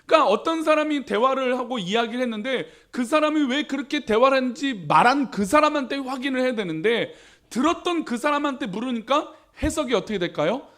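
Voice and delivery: ordinary talk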